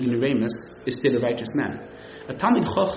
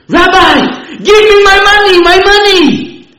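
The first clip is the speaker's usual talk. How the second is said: furious high yell for his money; shouting; 18 dB louder, slower than usual. Retold or imitated. Cheated guest screaming at the rabbi, high and loud. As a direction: scream it as if robbed. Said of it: "Why are you shouting?".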